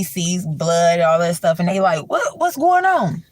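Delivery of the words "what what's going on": high startled question; confused demand. Retold or imitated. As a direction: demand to know what happened. Confused hosts at door.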